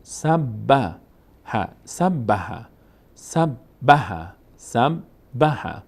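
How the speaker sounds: سب and به spaced out slowly; slow syllable demo. Shown as a demonstration. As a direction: split سب به apart, slowly. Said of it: "یک بار با فتحش". («سب، به» آهسته و جدا)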